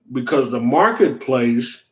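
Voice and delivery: usual speaking voice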